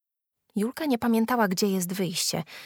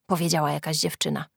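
ordinary delivery, nothing marked

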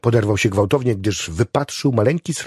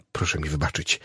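soft, low timid apology; timid insistence; 5 semitones lower, 6 dB quieter than usual. A narrator's reading. Chaplain begs the patient's pardon, timidly. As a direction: apologise timidly, keeping it small. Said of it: "nalegał bojaźliwie kapelan".

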